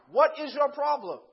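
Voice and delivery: loud, high acted demand; exasperated dad. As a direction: push each word out in exasperation.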